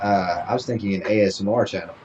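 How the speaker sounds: ordinary talk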